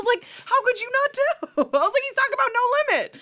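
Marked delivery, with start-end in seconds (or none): 0.4-1.6 much higher, exasperated squeal; mock outraged disbelief; incredulous. Exasperated retort to coworker. 2.0-3.2 much higher, put-on exasperated voice; high mock exasperation; incredulous. Exasperated retort to coworker.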